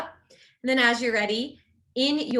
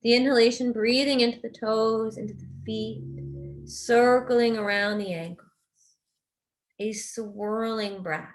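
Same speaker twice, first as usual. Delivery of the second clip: guided meditation. Real, not acted. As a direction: guide them gently into rest, unhurried and even.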